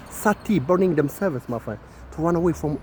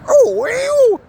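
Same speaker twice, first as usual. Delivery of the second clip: disgusted squeal; 8 dB louder than usual. Real, not acted. Disgusted exclamation.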